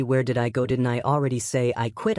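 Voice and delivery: plain reading voice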